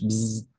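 short imitated buzzing of a fly. Shown as a demonstration. Teacher illustrating the name Antara as a fly, buzzing. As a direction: buzz briefly like a fly.

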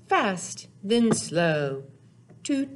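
plain reading voice

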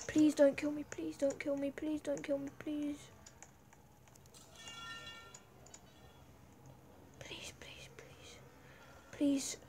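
pleading, fading to a whisper; terrified horror victim. Real, not acted. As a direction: beg for your life under your breath. Begging not to be killed.

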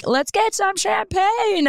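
high, slow and eager; excited. Retold or imitated. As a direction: naive excitement about the bubbly. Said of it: "So I'm like".

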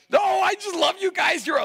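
high-pitched, drawn-out gush; gushing affection. Real, not acted. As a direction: gush over the crowd. Gushes over the crowd.

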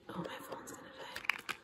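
barely audible whisper; hushed note to self; whispered aside. Real, not acted. Aside about her phone.

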